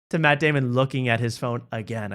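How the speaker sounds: ordinary delivery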